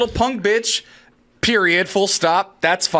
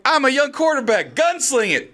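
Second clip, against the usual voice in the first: higher-pitched mock cocky quarterback; lightly mocking young gunslinger, pitched up; AOC; 7 semitones higher than usual. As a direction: play an overeager young quarterback. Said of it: "A O C's like".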